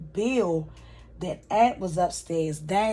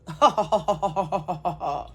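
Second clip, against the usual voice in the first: villain laugh. Retold or imitated. Madam laughing at the judge.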